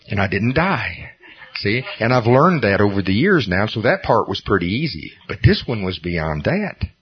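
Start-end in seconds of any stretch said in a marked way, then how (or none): none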